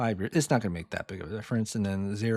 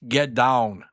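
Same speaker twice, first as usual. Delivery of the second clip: Arnold Schwarzenegger impression; 5 dB louder than usual. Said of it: "terrible impression of Arnold Schwarzenegger".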